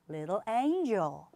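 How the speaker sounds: little angel slowly, two beats each; little angel, slow demo; slow model word. Shown as a demonstration. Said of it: "천사라는 말".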